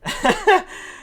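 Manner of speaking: high short giggle; chuckle. Real, not acted. Host tickled by the pirate ship plan, high chuckle.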